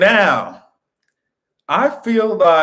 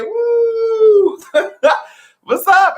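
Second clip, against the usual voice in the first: normal talking voice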